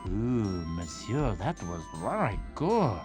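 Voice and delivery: put-on very low, French-accented compliment; French-accented praise. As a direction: admire it in a deep French accent. French narrator praises his rival.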